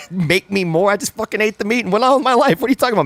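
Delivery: high-pitched mock incredulity; Bill Burr. Comedian retorting about the elk meat, high incredulous impression. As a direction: rant back in exasperated disbelief. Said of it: "Bill Burr's like".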